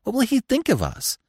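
high-pitched, anxious plea; pleading, pitched right up; urgent begging. A narrator's reading. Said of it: "Bambi begged".

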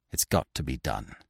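low and hushed, faltering resolve; stammering. A narrator's reading. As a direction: say it softly, nerve failing. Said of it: "she stammered".

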